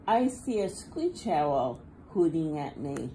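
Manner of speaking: ordinary delivery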